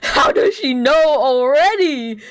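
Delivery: high, loud and slow, reliving the panic; loud mock horror at being caught; panicked disbelief. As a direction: squeal it out like you just got busted. Panicking about being found out.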